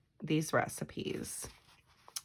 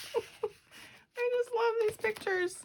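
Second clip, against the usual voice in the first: much higher, slow squealing delight; high slow swoon of delight; adoring gush; much slower than usual. Real, not acted. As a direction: melt over these pictures. Gushing over vintage pictures.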